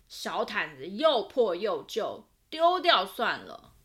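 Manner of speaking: a hint of a fed-up father tossing it away; dismissive dad. Dad telling the family to throw the blanket out, dismissive.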